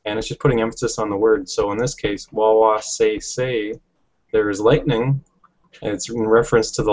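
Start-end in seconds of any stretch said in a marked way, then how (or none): 2.3-3.7 wa-wa say say slowly, each syllable said twice; slow demonstration of wa-wa say say with both syllables doubled; emphatic doubled syllables. Shown as a demonstration. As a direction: draw out wa-wa say say as two emphatic pairs. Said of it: "you're saying the syllable twice".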